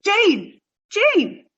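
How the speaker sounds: calling Jane's name loudly from across the room. Acted. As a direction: call out to her, startled by what she's doing. Irma calling out to Jane.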